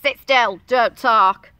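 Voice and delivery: loud teacher's command; stern teacher. A narrator's reading. Teacher tells the children to settle, loud command. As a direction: order the class loudly, at normal pitch. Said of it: "This is the teacher holding her chalk, telling the children".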